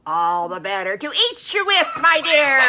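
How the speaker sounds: the wolf springs, bellowing and cackling; loud menacing villain with a gleeful laugh; wolf pounces. Acted.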